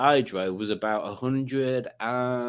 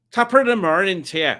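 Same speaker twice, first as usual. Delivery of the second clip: louder, higher stage-Irish greeting; stock Irish accent; 6 semitones higher, 5 dB louder than usual. Shown as a demonstration. Host shows the only Irish he can do, mock Irish accent. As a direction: do a cheery cliché Irish accent. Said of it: "the only Irish, uh, accent I can do".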